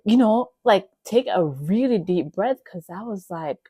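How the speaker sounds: normal talking voice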